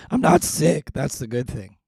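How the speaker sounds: normal talking voice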